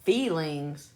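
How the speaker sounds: "Feelings" as questioned "fee-lings"; disbelieving standard pronunciation. Shown as a demonstration. Unconvinced it's said so, doubtful "fee-lings". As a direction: say "Feelings" with long ee and full ng, in doubt. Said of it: "That's how you really say it?".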